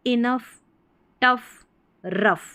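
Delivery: 'इनफ, टफ, रफ' each ending in F; model word drill. Shown as a demonstration. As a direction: drill इनफ, टफ, रफ one at a time. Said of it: "अफ की ध्वनि". ('इनफ, टफ, रफ' अलग-अलग, फ पर खत्म)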